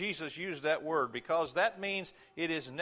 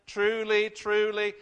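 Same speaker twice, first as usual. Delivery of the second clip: louder, pressing hard on each truly; loud emphatic proclamation; 4 semitones higher, 7 dB louder, slower than usual. Acted. Preacher voicing Jesus to the congregation, loud and emphatic. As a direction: ring it out like a flashing sign. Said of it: "shining a light bulb on this and saying".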